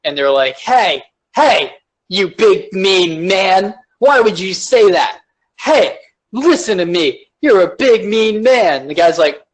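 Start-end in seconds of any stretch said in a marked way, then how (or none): 0.6-8.9 mock an oversensitive accuser, drawing the words out; whiny put-on telling-off; tantrum-throwing scold. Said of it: "these kids throwing tantrums".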